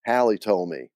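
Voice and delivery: usual speaking voice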